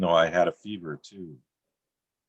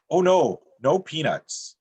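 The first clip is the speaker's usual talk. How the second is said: a sudden louder, higher blurt; blurting server; 10 semitones higher, 4 dB louder, slower than usual. Retold or imitated. Server hastily correcting the wife's peanut question, blurted. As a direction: blurt it out, flustered. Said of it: "then blurts".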